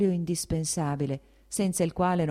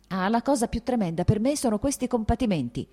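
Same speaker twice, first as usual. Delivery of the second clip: sudden irritated outburst, pitched higher; a burst of irritation; irritated cry; 6 semitones higher, 3 dB louder than usual. A narrator's reading. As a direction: flare up in annoyance. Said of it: "gridò Kitty irritandosi a un tratto".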